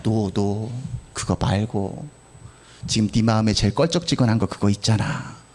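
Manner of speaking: low, hushed voice of God. Pretend. God answering the preacher's prayer, low and hushed. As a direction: gently correct him as God would. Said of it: "그랬더니 하나님이".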